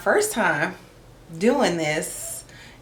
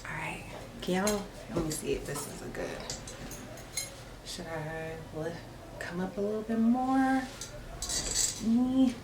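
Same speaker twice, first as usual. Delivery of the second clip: quiet aside; 12 dB quieter than usual. Real, not acted. Adjusting camera quietly, off mic.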